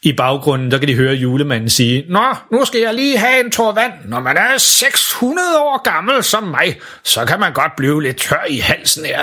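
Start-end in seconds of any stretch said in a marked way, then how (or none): 2.1-9.2 put-on unhurried six-hundred-year-old Santa; Santa Claus voice. Santa speaks to the children.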